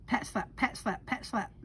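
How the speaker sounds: whispered pat-slap rhythm; soft slapping sounds. A narrator's reading. Storyteller to kids, softly imitating tail slaps. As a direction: tap it out softly like a tail on water.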